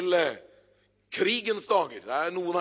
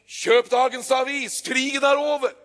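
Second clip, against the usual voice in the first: newsboy calling out; 7 semitones higher, 6 dB louder than usual. Acted. Newsboy announcing the war's end to the street. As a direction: shout the headline to passers-by. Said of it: "Og han sier igjen".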